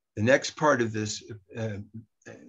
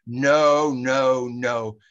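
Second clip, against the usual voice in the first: yelled no; 2 semitones higher, 8 dB louder, much slower than usual. Shown as a demonstration. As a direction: yell it out as loud as you can, then let it die down. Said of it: "yelling ... as loud as you possibly can".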